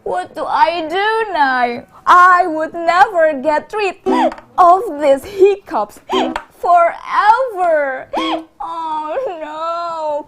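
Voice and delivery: high, loud mock wailing; tearful storybook lament broken by hiccups; crying shark. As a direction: wail in hammed-up despair, stretching the end. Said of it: "cried the Smiley Shark".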